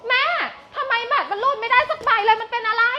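high, loud whining at the declined card; spoiled girl wailing over her card; whiny complaint. Acted.

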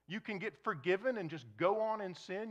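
usual speaking voice

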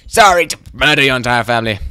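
hammed-up loud apology; theatrical fake sorrow; mock apology. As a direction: overplay the remorse. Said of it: "Ah, I sound sorry".

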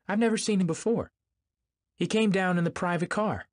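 high, protesting denial. A narrator's reading. Daisy denying she ever knew the guest, high protest. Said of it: "she denied".

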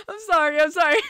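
high, loud laughter with apologies; loud laughing fit. Real, not acted. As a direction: laugh it out, sorry between bursts.

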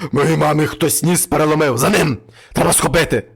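loud furious devil voice; angry devil. Acted. Devil enraged that his mother's nose is broken, loud. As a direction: roar it, ready to chase. Devil raging after the man.

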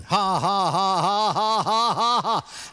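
evenly pulsed forced ha-has; put-on laughing. Preacher retells laughing again at the devil, put-on laugh. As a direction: mimic a long, deliberate laugh. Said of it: "I immediately started laughing again".

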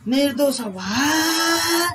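a mum hollering at her sleeping son, vowels drawn long; mother calling out. Retold or imitated. Mother calling to her son who is still asleep, drawn-out Bhojpuri call. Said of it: "mummy चिल्लाती होंगी".

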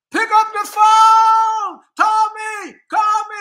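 high, loud, drawn-out holler; emphatic shouted demand. Acted. Telling a breadcrumber to pick up, emphatic shouting. Coaching a pleading demand to call.

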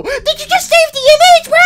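very high squeaky toy voice; Mister Hugs catching him saving the photo; squeaky accusing voice. Acted. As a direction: squeak out a suspicious question.

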